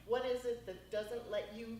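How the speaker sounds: ordinary talk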